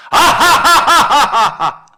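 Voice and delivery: high, loud, slow drawn-out ha-ha-ha; big demo laugh. Shown as a demonstration. Pastor models a laugh for the congregation, big and loud. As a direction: laugh out big to show them how. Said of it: "한번 웃어봅시다".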